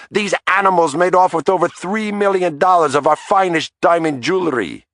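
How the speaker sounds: very high, shrieking rant; a wife tearing into the detective over the stolen diamonds, furious; furious tirade. A narrator's reading. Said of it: "barking at me even more rabidly".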